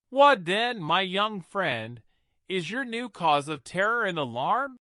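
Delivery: ordinary delivery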